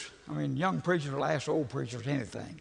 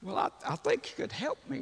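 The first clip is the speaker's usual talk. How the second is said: quivering plea; 7 semitones higher than usual. Retold or imitated. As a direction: plead haltingly, mock quiver in the voice. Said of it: "there's a quiver in his voice".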